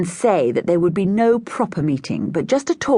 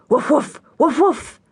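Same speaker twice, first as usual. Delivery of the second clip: high, louder barking woofs; dog bark; 10 semitones higher, 3 dB louder than usual. A narrator's reading. Girls at the door barking the password, playful woofs. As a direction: yap it out like a dog.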